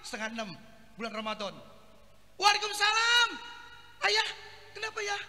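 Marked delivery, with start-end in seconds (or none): 2.4-5.2 a higher, sweet wife's reply; mock wife answering her husband. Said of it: "suaminya orang Jawa, bini Betawi".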